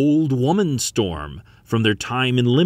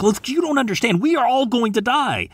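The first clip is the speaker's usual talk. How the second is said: high-pitched, desperate pleading; teenage girl insisting on doom, pitched way up; distraught protest; 13 semitones higher than usual. A narrator's reading. Frightened girl argues about dying.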